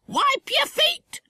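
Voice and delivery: very high, slow squawking parrot; stretched-out, high-pitched bird order; stern parrot. A narrator's reading. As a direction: scold like a pet parrot. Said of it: "she said sternly to the startled porter".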